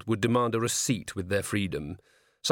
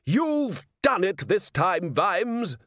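high, slow indignant priest voice; angry priest; 9 semitones higher, much slower than usual. A narrator's reading. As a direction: scold like an outraged priest.

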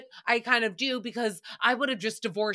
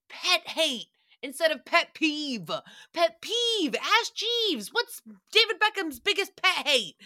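mocking phrase; 6 semitones higher than usual.

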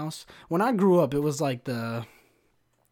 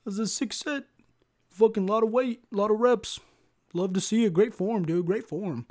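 high, drawn-out hype-bro voice; mock gushing fan; 7 semitones higher, 3 dB louder than usual. Gym commenters praising a squat video, mock gushing. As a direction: gush in a high, put-on voice.

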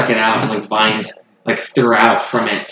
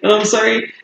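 flustered apology; 8 semitones higher than usual. Acted.

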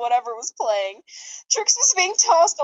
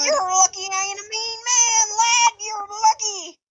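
higher, sing-song leprechaun voice; a hint of an Irish leprechaun; 8 semitones higher than usual. Leprechaun mascot warning the drivers, in a light sing-song voice. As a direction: lilt it like a cheeky leprechaun.

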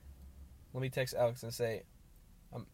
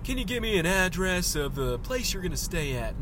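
Hank Hill; 5 semitones higher, 4 dB louder than usual. Acted. Gate guard asks visitor for an address, Hank Hill impression. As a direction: do a cartoon man's voice, pitched up. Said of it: "all the people at the gates are Hank Hill".

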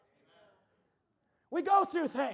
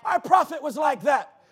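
excited praise; 2 semitones higher, 10 dB louder, slower than usual. Retold or imitated. Preacher recalling his joy at the message, loud. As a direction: shout your delight. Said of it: "And I thought".

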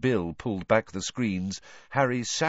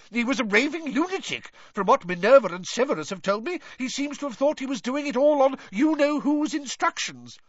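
high, fast pompous bluster. A narrator's reading. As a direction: dismiss it all with bluster. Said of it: "blustered Fudge".

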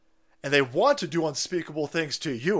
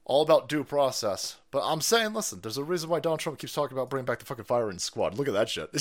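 ordinary talk, nothing marked

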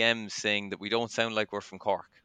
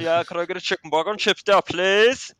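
put-on broad Cork accent, higher, louder and fast; thick Cork accent; 9 semitones higher, 9 dB louder than usual. Retelling ordering food from a waitress, in a broad Cork accent. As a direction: order like a Cork local, lilting up to "please". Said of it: "sometimes it comes out whenever I'm ordering food".

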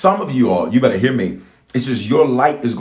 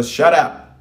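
all-caps emphatic; 5 semitones higher than usual. Shown as a demonstration. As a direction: fire it out as a rallying command. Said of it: "type it in all caps".

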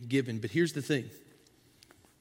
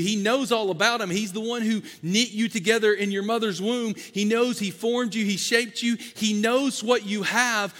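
preaching at full force; 5 semitones higher than usual. Acted. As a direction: build it up to the altar call.

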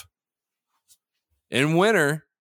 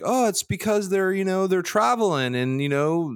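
higher, mocking imitation of him; mocking co-host's voice; 5 semitones higher than usual. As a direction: parrot his excuse in a teasing voice. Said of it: "And you're like".

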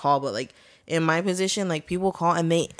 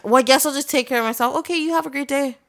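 high, put-on polite brush-off; mock huffy sign-off; 8 semitones higher than usual. Customer giving up on the call, high mock huffiness. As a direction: mimic a miffed customer's sing-out goodbye.

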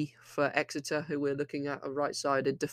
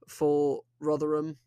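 ordinary talk, nothing marked

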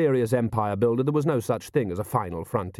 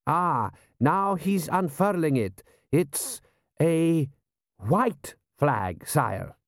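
slow, halting, higher-pitched general's voice; puzzled general; 6 semitones higher, much slower than usual. Acted. General reporting the unfurling flag to his prince, puzzled. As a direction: draw out each discovery with pauses.

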